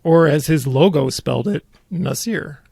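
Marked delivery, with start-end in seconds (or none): none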